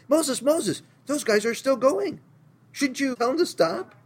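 put-on a young man running up, alarmed and urgent; urgent young messenger. Young guy running to Moses.

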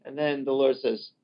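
normal talking voice

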